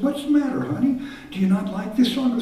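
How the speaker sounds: worried mother. Retold or imitated. Mother voiced in story.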